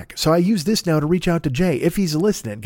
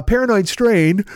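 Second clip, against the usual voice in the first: drawn out through a yawn; 5 semitones higher, slower than usual. Real, not acted. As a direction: fight off a yawn. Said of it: "fighting off yawns".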